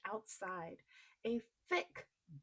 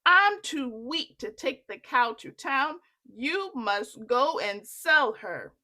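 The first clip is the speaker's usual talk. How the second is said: weary mother; 3 semitones higher, 11 dB louder than usual. Acted. Mother telling Jack to sell the cow, loud. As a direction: speak as a tired mother sending him off. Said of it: "Jack's mother said".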